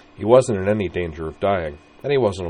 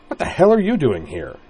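louder, higher flustered demand; indignant sputter; 6 semitones higher, 6 dB louder than usual. Acted. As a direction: splutter it out, caught off guard. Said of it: "Corwin sputtered".

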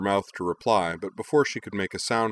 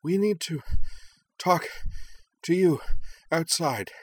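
higher, broken up by breaths; panting; 10 semitones higher than usual. Acted. Fu, still winded, urging Nal outside while panting. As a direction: gasp it out between breaths. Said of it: "Fu panted".

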